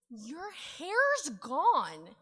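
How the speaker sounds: put-on high, drawn-out kid voice; slow, blunt toddler. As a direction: stretch it out like a grumpy little kid. Child's blunt verdict voiced.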